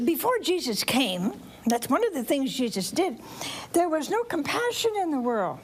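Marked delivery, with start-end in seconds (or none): none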